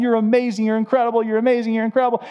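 gushing hype. Retold or imitated. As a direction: pile on the hype like a fan.

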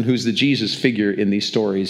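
normal talking voice

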